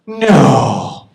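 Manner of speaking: loud, drawn-out mock disbelief; pretending amazement at the frozen chicken, mock awe. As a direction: gasp a fake no. Feigning awe.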